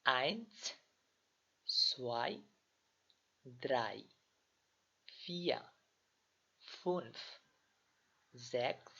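slow, careful German numbers one to twenty; German counting drill. Shown as a demonstration. Teacher repeats German numbers slowly for students, carefully. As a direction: count each German number clearly for learners. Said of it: "আমি আবার বলছি".

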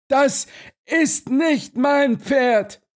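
very high, drawn-out exclamation; indignant cry. A narrator's reading. Owner of Silberstrahl rejects the horse, crying out. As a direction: cry out slowly in high-pitched protest. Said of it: "rief der Besitzer des Silberstrahl".

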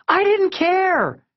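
very high, drawn-out outburst; exasperated cry. A narrator's reading. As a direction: cry it out slowly, covering your ears to the argument. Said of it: "she cried".

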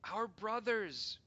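high, loud, slow desperate cry; cried out. Acted. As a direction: stretch it out in desperation. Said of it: "we cried".

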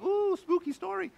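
mock-spooked. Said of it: "We know 'em, like".

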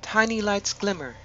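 measured meditation voice with long pauses between phrases; guided meditation. Acted. Host guiding listeners to relax and imagine an ancient wood, guided meditation. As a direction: lead them gently inward, leaving space after each phrase.

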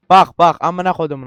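loud burst of cursing; angry swearing. Real, not acted. Gamer thrown back after a hard climb, angry swearing. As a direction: curse loudly at the game.

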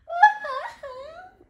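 high, loud pretend boo-hoo; hammed-up child's sob; mock crying. A narrator's reading. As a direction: wail like a storybook child crying. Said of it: "began to cry".

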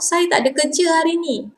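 quick casual take. Shown as a demonstration. Teacher shows the fast everyday way to say it, quick and casual. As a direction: rattle off "Saya tak ada kerja hari ni" quickly, with the words blending into each other.

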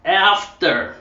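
mock American getting "After" wrong with a hard R; American slipping back on "After"; failed American attempt.

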